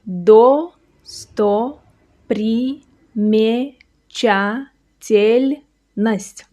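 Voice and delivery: drawn out syllable by syllable, very slow; very slow demo. Shown as a demonstration. Teacher sounding out the long word very slowly. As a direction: spell it out slowly for the learner. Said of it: "Watch slowly".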